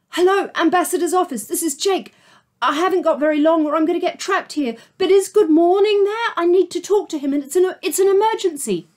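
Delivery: high, fast and loud urgency; urgent. Acted. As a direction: rush it out in a panic. Jake urgent on the phone.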